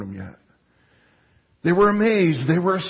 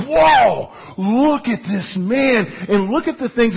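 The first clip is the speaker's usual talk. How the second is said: awestruck outcry, higher and louder; amazed crowd; 5 semitones higher, 4 dB louder, slower than usual. Retold or imitated. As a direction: gasp it out like a stunned crowd. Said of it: "They were like".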